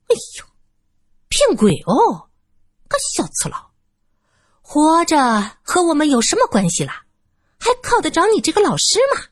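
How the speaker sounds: 尖细拿腔拿调的老妇; 尖声挖苦嘲讽; 阴阳怪气. Acted. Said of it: "那个女人阴阳怪气". (老女人阴阳怪气地讥讽老师)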